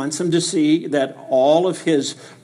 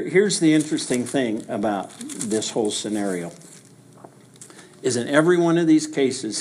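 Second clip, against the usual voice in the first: ordinary talk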